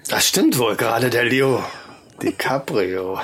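low, eerie killer murmur; menacing psycho, savoring each word; psychopath killer voice. Pretend. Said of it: "sprich wie ein psychopathischer Killer".

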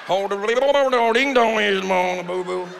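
put-on garbled, gurgling Southern accent parody; yodeling underwater accent. Comic demonstrating yodeling underwater, garbled mocked accent. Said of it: "sound like they're yodeling underwater".

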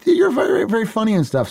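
mock high gushing. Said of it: "she was like".

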